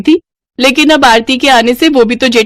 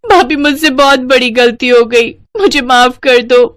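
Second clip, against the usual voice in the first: pleading, near tears; a remorseful young bride apologising; tearful apology; 4 semitones higher than usual. Acted.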